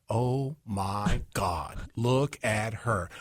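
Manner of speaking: slow gossipy gasp; drawn-out valley girl. Retold or imitated. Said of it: "reenact the beginning part of Baby Got Back".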